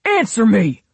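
loud, high furious yell; enraged, explosive command; angry demand. A narrator's reading. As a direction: shout it as an order. Bully screams at daughter.

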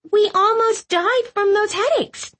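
high whiny complaint; complaining. A narrator's reading. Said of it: "Clove complained".

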